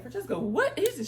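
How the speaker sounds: high call across the room. Retold or imitated. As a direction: call back to a friend. Calling to friend behind.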